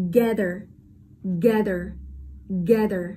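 gather with GA punched; strong GA, light unstressed ending in gather; stress on first syllable. Shown as a demonstration. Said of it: "không nhận trọng âm".

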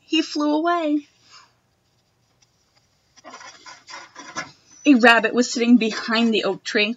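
put-on expressive read-aloud for children; reading a picture book to a class; storybook reading. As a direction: read it like story time for little kids.

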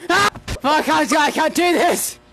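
high, panicked protest; scared screaming. Real, not acted. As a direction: cry out that you give up. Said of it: "I've screamed too much".

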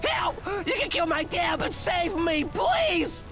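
high-pitched panicked begging; desperate pleading. Acted. Son to torturer, offering up his dad, desperate pleading. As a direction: beg frantically for your life. Captive begs for his life.

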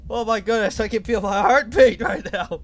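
high, loud rush of adrenaline; breathless excitement. Real, not acted. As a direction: still buzzing from the scare. Heart racing after the chase.

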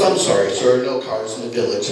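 a bored clerk saying no; snooty desk boy. Retold or imitated. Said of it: "the little boy at the desk goes".

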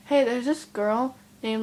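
ordinary talk, nothing marked

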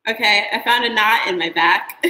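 ordinary talk

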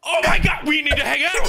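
high, loud burst of delight; excited shout. Real, not acted. Friend proposing to hang out in Boston, excited shout. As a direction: yell it, thrilled to meet up.

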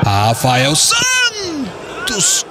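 very slow drawn-out name; excited stretched call. Real, not acted. Dangerous free kick.

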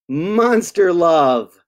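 slow, drawn-out refrain. Acted. Reader closes each verse for children, slow drawn-out refrain. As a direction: stretch each word of the refrain.

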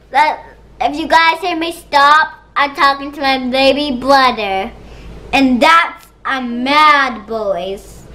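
ordinary talk, nothing marked

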